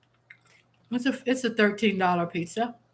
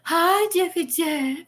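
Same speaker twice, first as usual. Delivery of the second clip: high, slow, sing-song hi; lilting playful greeting; 8 semitones higher, slower than usual. Retold or imitated. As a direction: stretch the hi out sweetly. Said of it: "I'm the one that says".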